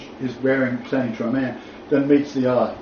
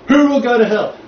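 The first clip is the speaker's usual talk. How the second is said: loud, high, thundering imitation; hammed-up hellfire condemnation; mock hellfire preacher; 9 semitones higher, 7 dB louder than usual. Teacher imitating a condemning article writer, mock hellfire preaching.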